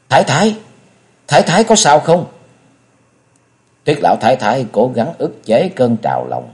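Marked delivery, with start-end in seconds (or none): 0.1-2.2 high-pitched anxious questioning; alarmed, hurried. A narrator's reading. Bành Đại Nương checks on her stricken mistress, alarmed. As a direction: call out in alarm for your mistress. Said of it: "kinh hãi hấp tấp hỏi".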